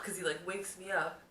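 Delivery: normal talking voice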